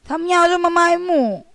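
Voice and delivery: put-on high childish whine; a kid protesting to the class; whiny kid. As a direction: complain like a sulking boy. Mexan whining about the tail.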